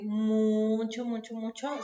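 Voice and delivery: first "mucho" drawn out and punched; emphatic repetition. Real, not acted. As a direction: stretch the first "mucho", then repeat it for emphasis. Teacher stresses how often it is confused.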